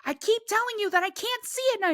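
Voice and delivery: high exasperated protest. Retold or imitated. Both freaking out.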